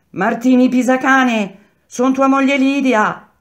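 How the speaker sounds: very high, loud calling cry; woman shouting. A narrator's reading. Third wife to her jailed husband, shouting. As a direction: holler up to a man behind bars. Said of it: "E la terza".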